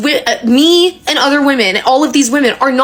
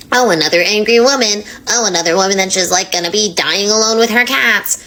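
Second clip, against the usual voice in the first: lower, sneering mock of a smug troll; mocking commenter voice; 4 semitones lower than usual. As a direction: parody a dismissive commenter, dripping with scorn. Mocking expected hater comments.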